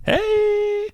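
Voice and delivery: squeaky high hype call. Pretend. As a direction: chirp it like a hype man.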